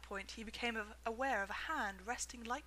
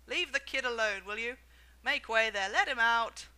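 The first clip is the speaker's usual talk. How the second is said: shouting; 10 dB louder than usual. A narrator's reading. Shopkeeper shouting the crowd down, loud and firm. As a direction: shut the crowd down. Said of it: "the fat shopkeeper shouted".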